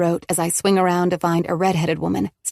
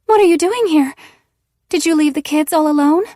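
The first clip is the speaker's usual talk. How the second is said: much higher, louder voice of another woman; Eve's voice; 10 semitones higher, 6 dB louder than usual. Acted. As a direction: slip into Eve scolding her friend. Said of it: "She sounds just like Eve".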